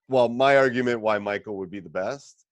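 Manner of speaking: ordinary delivery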